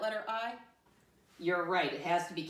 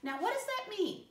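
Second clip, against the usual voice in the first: much higher voice, imitating a puzzled pupil; high mock-student query; voicing pupils' question. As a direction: pipe up high as the class asking. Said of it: "That's what you're asking".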